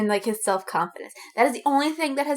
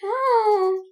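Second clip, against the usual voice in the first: mock cry; 10 semitones higher, 6 dB louder than usual. Real, not acted. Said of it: "I have this fic".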